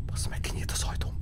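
breathless whisper, barely voiced; frightened whisper. Real, not acted. Streamer hiding from the monster, frightened whisper. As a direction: whisper so it can't hear you.

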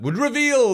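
"reveal" stretched high and loud; emphatic stress. Real, not acted. Host explaining what gives Danes away, emphatic stress. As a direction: punch "reveal" with a big high swell.

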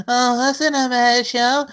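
a hint of a sweet, coaxing voice, slowed down; mocking her sweet voice. Teasing his co-host by copying her gentle pitch. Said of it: "I don't talk like that".

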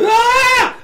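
sudden high yelled "Ah!"; startled scream. Retold or imitated. Recalling waking to Quint eaten, a sharp scream. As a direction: kid jolted awake by the shark, screaming. Woke up seeing shark attack.

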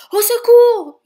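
high, very loud shout for help; panicked yell. A narrator's reading. Jacques being transformed by the wand, yelling. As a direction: scream out in alarm. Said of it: "hurle Jacques".

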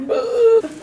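high retching groan through laughter; disgusted groan. Retold or imitated. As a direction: gag out a laughing ugh. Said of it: "and it's like".

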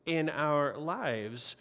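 usual speaking voice

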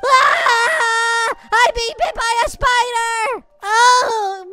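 high, loud cries of alarm; panicked screaming. Acted. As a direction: yell as if being bitten. Being bitten by a spider.

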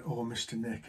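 low, soft, imitated dejection; sorrowful. New agent glumly answering his manager, sorrowful. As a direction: mimic a downcast trainee. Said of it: "looked at me all sorrowfully and said".

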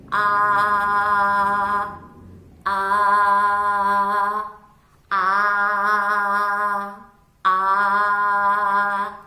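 put-on showing children how to open wide; loud long aah. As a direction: hold a big open aah. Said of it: "loud drawn-out ah sounds".

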